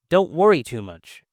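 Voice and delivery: normal talking voice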